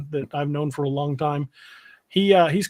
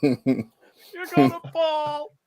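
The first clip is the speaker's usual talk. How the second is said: mock fearful warning; 12 semitones higher, much slower than usual.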